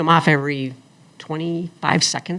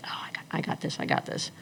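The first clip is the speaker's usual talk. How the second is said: unvoiced, soft confident whisper; whispered boast; 10 dB quieter than usual. Brushing off the warning, whispering I got this. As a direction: breathe it out, sure of yourself. Cocky self-quote, whispered.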